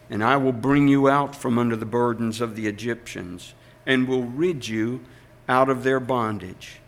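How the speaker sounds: ordinary delivery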